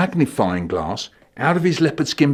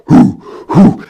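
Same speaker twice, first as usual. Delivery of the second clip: caveman hooting; 5 semitones higher, 9 dB louder than usual. Pretend. Caveman brother approaching.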